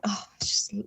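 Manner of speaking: barely voiced, a soft choked whisper; breathy half-whisper. Family hearing mum's goodbye speech, whispered. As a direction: let it fall to an unvoiced hush.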